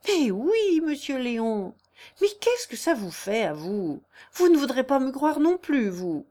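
high-pitched, insistent outburst, easing between phrases; exasperated protest. A narrator's reading. Jeanne answers Léon's doubts, exasperated protest. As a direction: protest that nobody will believe you.

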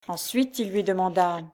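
ordinary delivery, nothing marked

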